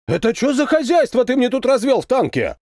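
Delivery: high-pitched angry scolding; angry shouting. A narrator's reading. Captain berates tankman over his tank, angry shouting. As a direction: shout a demand for an explanation. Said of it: "кричал Зорин".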